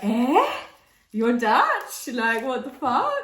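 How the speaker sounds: loud, high-pitched disbelief; astonished reaction. Retold or imitated. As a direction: re-enact a friend's startled double take. Retold shock at waiter speaking Dutch.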